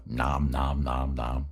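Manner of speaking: playful chewing sounds, low; mock munching noises; nom nom chewing. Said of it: "I'm chewing on the comments".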